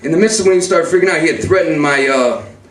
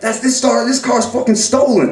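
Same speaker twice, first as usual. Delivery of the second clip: higher, agitated retort; retold accusation; 7 semitones higher than usual. Accusing his brother of stealing the car, agitated. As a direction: accuse him with raised pitch.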